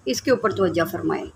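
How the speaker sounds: ordinary talk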